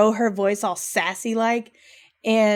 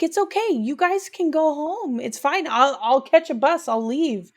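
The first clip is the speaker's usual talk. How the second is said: put-on higher, small girl's voice giving in; child's voice; 5 semitones higher than usual. Daughter to her parents at the bus stop, small higher voice. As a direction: play the little girl bravely saying it's fine. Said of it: "she's like".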